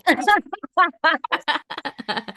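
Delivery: high emotional laugh through tears; a real teary laugh. Real, not acted. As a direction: laugh to cover welling up. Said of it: "I'm just gonna stop talking".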